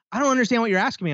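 fast, high mock cashier whine. Retelling the cashier to his co-host, high and fast mock voice.

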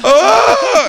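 long high-pitched shriek; loud mock scream of a frantic woman; imitated scream. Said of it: "it was like a head fake. She goes".

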